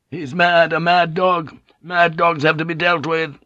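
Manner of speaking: high, raw outburst of anger; raw-voiced shout. A narrator's reading. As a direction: shout it raw, pitched well up. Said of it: "Ishido's voice was raw".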